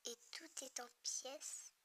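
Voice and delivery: breathy ominous whisper; hushed whispered reading. Acted. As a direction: hush it like a dark secret. Reads ominous line in whisper.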